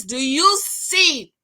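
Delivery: slow, then high and loud on five; building outrage. Real, not acted. Talking to the ladies about the cheating, rising to a shocked shout. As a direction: build it up to a scandalized peak. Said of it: "Right, ladies?".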